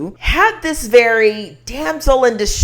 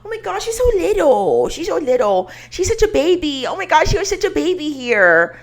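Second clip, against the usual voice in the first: much higher, cooing fangirl squeal; gushing fan voice; 8 semitones higher than usual. Retold or imitated. Mocking fans in comment sections with a high gushing coo. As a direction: gush over a tiny celebrity. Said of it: "They literally be like".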